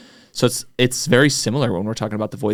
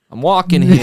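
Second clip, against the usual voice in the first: put-on brash New York accent, higher and louder; 7 semitones higher, 7 dB louder than usual. Joking how a New Yorker asserts himself, New York accent. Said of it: "Are you, are you walking here?".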